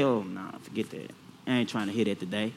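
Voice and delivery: soft, fast brush-off; dismissive aside. Retold or imitated. Preacher mimicking churchgoers who ignore God's voice, dismissive. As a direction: wave it away under your breath.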